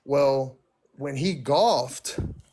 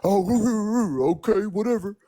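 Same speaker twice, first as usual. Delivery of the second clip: high, dismissive mock of the boyfriend; imitating a sulking boyfriend brushing her off; mocking him; 6 semitones higher, 3 dB louder than usual. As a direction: toss it off in his sulky voice, pitched up. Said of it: "and he was like".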